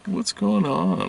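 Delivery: high, slow baffled question. Retold or imitated. Telling how she felt when the healer lied. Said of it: "I was just like".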